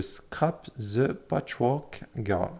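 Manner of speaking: English words in a French accent, said slowly; careful, halting French-accented English. Real, not acted. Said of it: "mon accent".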